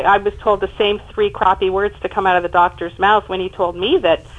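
normal talking voice

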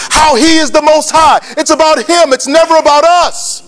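high, driving sermon build; impassioned preacher in full flow; full-force preaching. Real, not acted. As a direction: preach it in rapid-fire litany, rising to a ringing climax.

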